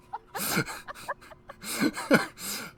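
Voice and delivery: high breathy villain cackle; sinister laugh. Acted. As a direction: laugh like the lurking entity. Narrator voices the sinister entity.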